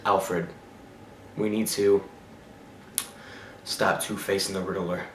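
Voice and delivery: low, soft, lifeless monotone; bored Val Kilmer Batman. Retold or imitated. Reviewer mocking Val Kilmer's dull Batman, low and soft. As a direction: mumble it low like a Batman who's given up. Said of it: "this is how he talks".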